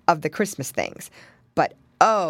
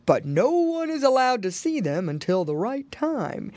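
higher, emphatic storybook Santa; jolly old Santa; 6 semitones higher than usual. A narrator's reading. Santa telling piglets about presents, jolly explaining. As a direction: explain grandly to the piggy boys.

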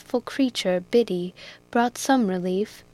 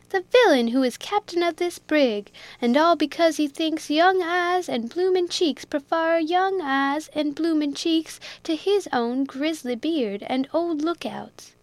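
higher, scornful accusation; bitter Jack; 7 semitones higher than usual. A narrator's reading. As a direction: sneer at the old captain.

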